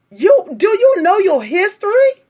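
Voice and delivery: high, loud, rising reproach; scolding. Real, not acted. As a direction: scold them for not knowing. Scolding commenter.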